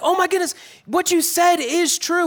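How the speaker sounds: imagine Mary seeing Jesus alive, delighted; excited. Retold or imitated. Mary seeing the risen Jesus, high and thrilled. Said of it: "excited, like".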